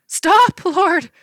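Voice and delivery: high, loud panicked horse cry; desperate high horse wail. A narrator's reading. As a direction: shriek it like a terrified horse. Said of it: "a horse cried".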